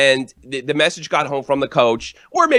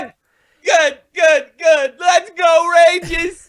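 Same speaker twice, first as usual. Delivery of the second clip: shouted, much higher cheering; gleeful cheer; 5 dB louder, slower than usual. Real, not acted. To the host, a Rangers fan shouting in glee at the Islanders' loss. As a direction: yell it like a rabid fan.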